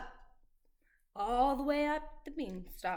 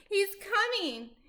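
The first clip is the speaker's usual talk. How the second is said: alarmed warning; 8 semitones higher, 4 dB louder than usual. Acted. Giantess warns Jack.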